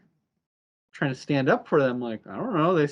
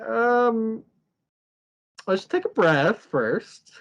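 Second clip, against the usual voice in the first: higher, slow, drawn-out suggestion; retold attempt to slow everyone down; mock careful calming; 8 semitones higher, 4 dB louder, much slower than usual. Mabel telling the others to take a breath, mock calming.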